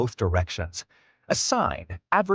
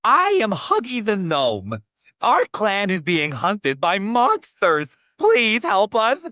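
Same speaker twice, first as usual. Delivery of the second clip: a tiny creature begging, higher; gnome voice; 10 semitones higher, 3 dB louder than usual. Acted. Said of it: "One of the gnomes approaches you".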